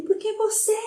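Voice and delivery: high shrill-free mock yell of a child; imitating a kid's angry yell. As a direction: voice the boy shouting at the teacher. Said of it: "Gritando comigo".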